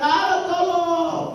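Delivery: slow, drawn-out mock cry for help; mock scream. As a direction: wail it slowly like a man in the fire.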